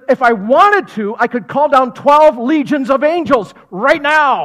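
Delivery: "twelve legions" loud, high and slow; slow mighty declaration. Acted. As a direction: proclaim it like thunder. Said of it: "He said".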